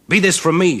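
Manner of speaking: high, loud outburst; loud refusal. Acted. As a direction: fling the words away and flee. Champion refuses the wedding.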